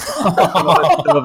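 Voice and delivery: laughing. Real, not acted. Joking self-pity with laugh.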